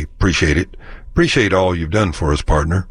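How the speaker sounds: "Appreciate" said as "preciate", first A dropped; Texas appreciate. Shown as a demonstration. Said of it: "dispense with the A in appreciate".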